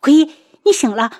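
sudden high, loud, quick exclamation; startled cry. A narrator's reading. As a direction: cry out in shock as he wakes. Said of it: "楚清瑶才惊呼一声".

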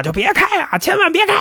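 high, strained cry of warning; acted calling out. Mother held at knifepoint warns her daughters, acted high-pitched cry. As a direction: call out a desperate warning, pitch climbing high. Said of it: "就跟门口喊啊".

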